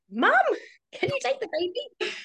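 high-pitched mock plea to mum; pleading call. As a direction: call out high for mum's help. Acts out calling mum for help.

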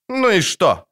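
high, dismissive comeback; defiant retort. A narrator's reading. Dryad shrugs off witcher's reproach, defiant.

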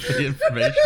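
high giggling mock thanks; laughing. Real, not acted. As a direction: squeak it out through laughter.

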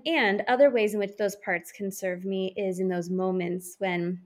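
usual speaking voice

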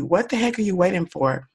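ordinary delivery

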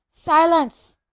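huge furious yell. Acted. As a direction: bellow for silence. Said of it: "yelled Uncle Vernon".

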